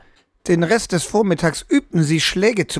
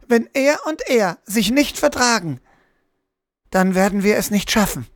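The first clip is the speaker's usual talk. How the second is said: put-on high, hesitant little girl's voice; stockende Kinderstimme; 6 semitones higher than usual. A narrator's reading. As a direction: falter and restart as an unsure child. Frightened child haltingly explains vision. (mit hoher Kinderstimme, zögernd und stockend)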